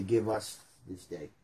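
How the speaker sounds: the group version, said softly. Shown as a demonstration. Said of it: "But if I'm with people, I say".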